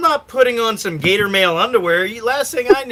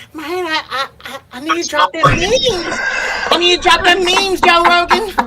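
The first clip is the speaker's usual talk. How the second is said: high, hollered character voice, building louder; Tyrone; 11 semitones higher, 4 dB louder than usual. Acted. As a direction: stammer in high, then yell it out. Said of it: "He's probably sitting there like Tyrone".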